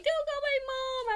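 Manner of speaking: imitated high-pitched wailing plea; crying plea. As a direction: beg in a mock-crying squeal. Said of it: "I'm, like, crying and trying".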